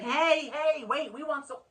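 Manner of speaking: high pretend shout; calling out to the waiter; mock call out. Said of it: "I'm not gonna yell out".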